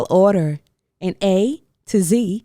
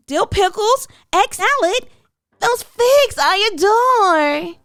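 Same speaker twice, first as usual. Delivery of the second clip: put-on much higher, bright little girl voice; sing-song child ordering lunch; little girl rattling off her order, much higher; eager little girl; 8 semitones higher than usual. A narrator's reading. Hannah's voice, high.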